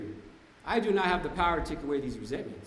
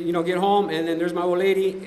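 usual speaking voice

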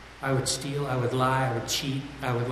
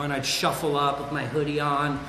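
usual speaking voice

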